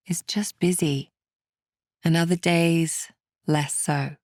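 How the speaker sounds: calm, unhurried meditation guide, with long pauses; guided meditation. Acted. Meditation teacher settling listeners to calm political worries, calm and measured. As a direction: ease the listener gently through each step.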